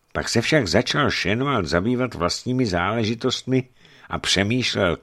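ordinary delivery, nothing marked

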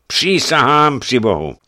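high, loud oath falling at the end; emphatic boyar taking an oath; solemn oath. A narrator's reading. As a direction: swear it emphatically, as a statement. Boyar swears his innocence.

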